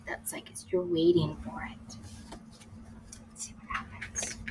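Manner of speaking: hushed suspense aside; whisper. Real, not acted. Parent teasing suspense to child, whispered. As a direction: breathe it, building suspense. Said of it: "reading aside, hushed".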